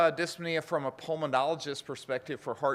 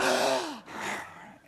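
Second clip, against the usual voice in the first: big gasp; 12 semitones higher than usual. Shown as a demonstration. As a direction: suck air in hard. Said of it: "let's everybody do it this morning".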